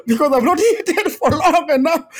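laughing through the words, high and loud; real laughter, pitched high. Real, not acted. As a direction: laugh it out at the memory.

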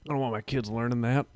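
mock Southern parent, lightly voiced; southern accent. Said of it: "I gave that a southern accent".

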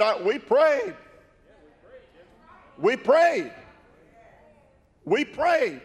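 high, pushed repeats of prayed; emphatic insistence. Retold or imitated. Preacher retelling the healed man defending himself, emphatic. Man's emphatic retold answer.

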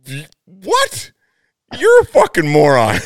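high, loud disbelieving yell; incredulous outburst. Retold or imitated. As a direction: explode in disbelief at the buddy. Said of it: "I'm like".

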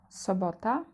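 ordinary delivery

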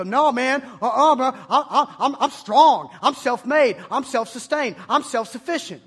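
loud, laughing macho boast; cocky tough guy. Retold or imitated. Preacher mocks students, loud cocky jock boast. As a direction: puff up like a proud jock. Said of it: "You go".

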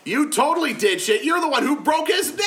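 loud accusing shout. Acted. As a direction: yell it, pointing the finger. Accusing friend in mock quarrel.

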